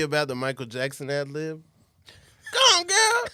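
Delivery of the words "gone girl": shrieked, very high and loud; excited shout. Real, not acted. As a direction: blurt it out in a squeal. Excited movie title outburst.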